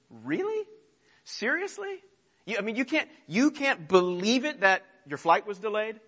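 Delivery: "Really? Seriously?" shot way up in mock astonishment; mock incredulity. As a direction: needle them with exaggerated disbelief. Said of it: "his response to that sentiment is".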